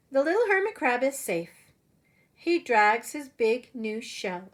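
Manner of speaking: lively storybook read-aloud voice; animated picture-book narration; reading to children. Acted. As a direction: read it like story time for little kids. Teacher reads picture book aloud.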